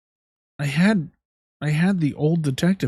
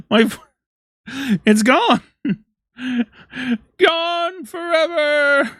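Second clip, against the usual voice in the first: very high, laughing wail; mock-tragic lament, breaking into laughs; laughing despair; 13 semitones higher, 3 dB louder, slower than usual. Real, not acted. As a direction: wail it through laughter, drawn out. Mock-mourning the lost voice, laughing.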